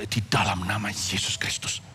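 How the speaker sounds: slow, whispered invocation; hushed command. Retold or imitated. Preacher retelling his first exorcism, slow whispered command.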